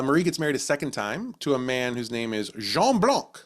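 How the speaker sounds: usual speaking voice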